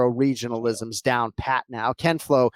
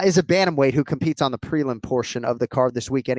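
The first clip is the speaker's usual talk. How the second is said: ordinary talk, nothing marked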